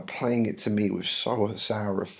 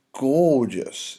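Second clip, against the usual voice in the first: "gorgeous" higher, louder and stretched; gushing emphasis; 5 semitones higher, 5 dB louder than usual. Acted. Nurse admiring Romeo to Juliet, "gorgeous" gushed. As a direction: swoop up and linger on "gorgeous".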